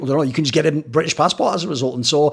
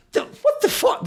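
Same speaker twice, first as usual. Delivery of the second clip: leaps much higher in stunned disbelief; baffled shock; slower than usual. Retold or imitated. Guest reacts to bartender pointing at the Queen, shocked and high. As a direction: caught totally off guard, squeaky shock. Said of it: "I'm like".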